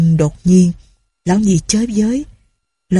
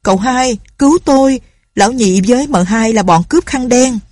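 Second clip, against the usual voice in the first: screaming out; 5 semitones higher than usual. A narrator's reading. Prisoner to cậu Hai, screaming for help. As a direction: shriek for help then accuse. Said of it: "Hai Lành rú lên".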